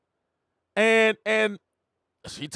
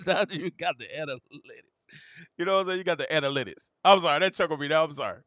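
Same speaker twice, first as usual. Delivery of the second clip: ordinary delivery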